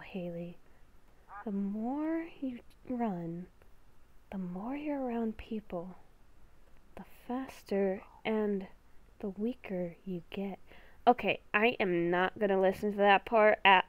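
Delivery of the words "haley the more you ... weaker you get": beast within voice. Acted. Inner beast whispering a warning to Haley, hushed and ominous. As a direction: whisper like a creature living inside her head.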